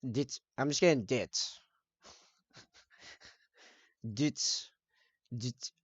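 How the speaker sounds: "Dit" repeated as short, soft, clipped taps; mouth drumming. Shown as a demonstration. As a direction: tap out "dit, dit" like a drum with the mouth. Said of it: "now sounds like I'm drumming with my mouth".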